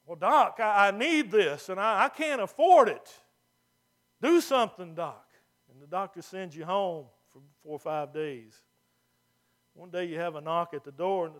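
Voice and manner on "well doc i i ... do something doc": desperate loud plea. Pretend. Patient begs doctor, can't afford.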